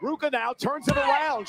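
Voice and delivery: ordinary talk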